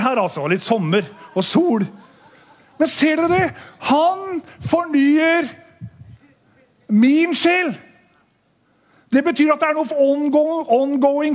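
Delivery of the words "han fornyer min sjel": fornyer stretched very slowly, min sjel lifted; slow emphatic scripture. Acted. Preacher stresses the psalm line, slow emphasis. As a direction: linger on each word, then lift the end.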